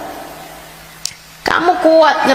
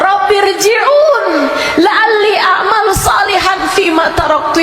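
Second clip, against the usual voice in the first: desperate plea; 11 semitones higher than usual. Acted. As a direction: cry out to be sent back. Voicing the dead begging God. (ratapan memohon dengan suara tinggi dan keras)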